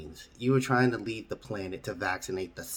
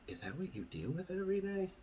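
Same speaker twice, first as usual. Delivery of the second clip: higher, softer odd alien voice; 8 semitones higher, 11 dB quieter than usual. Acted. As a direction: ask like a curious little alien.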